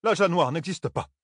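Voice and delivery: high-pitched indignant denial; grumbled denial. A narrator's reading. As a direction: dismiss it with irritation. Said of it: "maugréa Thalène". (dénégation agacée, voix aiguë)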